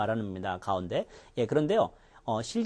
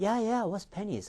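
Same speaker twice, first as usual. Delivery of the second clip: higher, drawn-out imitation of a baffled question; retold puzzled bystanders; 7 semitones higher, much slower than usual. Bystander asks about the doctor's baffling word, higher puzzled question. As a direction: act out a listener butting in to ask. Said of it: "옆에 사람들".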